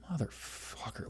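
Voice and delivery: breathless whispered awe; whispered swear. Retold or imitated. Watching a shirtless athlete walk by, whispered awe. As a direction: hiss it under your breath. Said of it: "and I'm just like".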